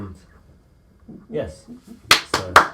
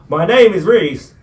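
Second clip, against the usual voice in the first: shouted, much higher and slower; to someone hard of hearing; 9 semitones higher, 16 dB louder, slower than usual. Real, not acted. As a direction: yell it so they finally hear. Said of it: "Bit louder, please".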